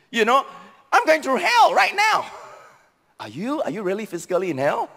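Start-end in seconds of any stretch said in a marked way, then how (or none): 0.9-2.3 high-pitched mock exasperation; overdramatic complaint. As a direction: mimic someone wailing about their lot. Mimicking people's overdramatic complaints.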